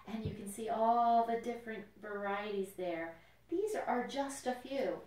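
ordinary delivery

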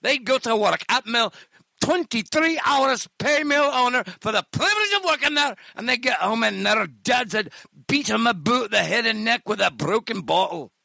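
hammed-up Scottish hard-luck tale; Scottish accent. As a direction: recount childhood misery in a broad Scottish accent. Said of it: "it sucks to be Scottish".

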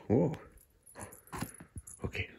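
ordinary talk, nothing marked

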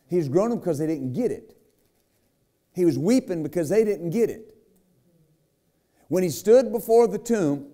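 rising, pressing sermon delivery with dramatic pauses; preaching. Acted. Preacher retelling Lazarus to the congregation, fervent preaching. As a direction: preach it to the church, building and dropping.

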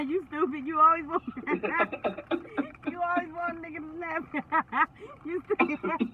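laughing through the words at a friend. Real, not acted. Teased by his friend, laughing while he answers. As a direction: chuckle it out, amused.

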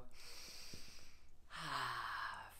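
long, low, soft sigh; letting-go sigh. Shown as a demonstration. Yoga leader telling class to let burdens go, long low sigh. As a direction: sigh the burden away slowly. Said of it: "just let it go".

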